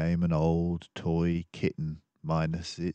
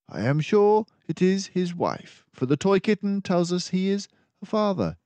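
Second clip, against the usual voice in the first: much higher, louder crisp detective mouse; a composed mouse detective taking charge; Basil the detective; 12 semitones higher, 6 dB louder than usual. Acted. Basil explains the patch.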